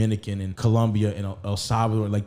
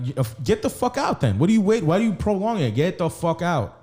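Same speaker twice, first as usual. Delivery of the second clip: retold angry yelling; 6 semitones higher than usual. Telling his girlfriend to get out, heated yell. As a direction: throw her out in a heated rant. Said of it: "I'm like".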